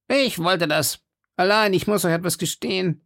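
high-pitched confessing musketeer; Porthos. Acted. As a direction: own up in a high character voice. Porthos's voice in read story.

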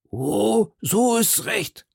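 slow, high, hollow voice; drawn-out distant reply; man behind door. A narrator's reading. Athos answering from the cellar.

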